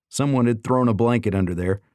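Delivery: ordinary delivery, nothing marked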